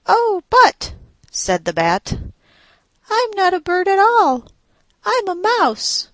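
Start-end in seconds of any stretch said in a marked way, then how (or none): 0.1-0.9 high pleading bat. A narrator's reading. 3.1-6.1 high pleading bat. A narrator's reading.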